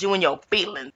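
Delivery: ordinary talk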